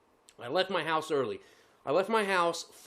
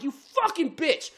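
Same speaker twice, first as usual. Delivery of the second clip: cussing out; 9 semitones higher, slower than usual. Retold or imitated. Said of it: "I start cussing".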